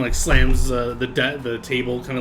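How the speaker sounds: ordinary delivery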